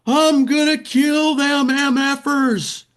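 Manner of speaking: very high, drawn-out imitation screech; retold woman's scream. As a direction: imitate an enraged fan screaming, slow and high-pitched. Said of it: "And she screams".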